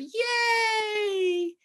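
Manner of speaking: high shouted yay; crowd cheer. A narrator's reading. Teacher voicing the cheering crowd, high shout. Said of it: "the crowd cheered".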